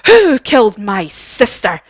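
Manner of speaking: loud, angry witch demand; big, menacing villain outburst; Wicked Witch. Acted. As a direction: demand it as a furious storybook witch. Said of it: "And the witch says to Dorothy".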